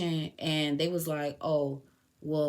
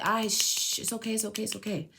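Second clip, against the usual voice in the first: soothing hush; 4 semitones higher than usual. Retold or imitated. Soothing the dogs in cage.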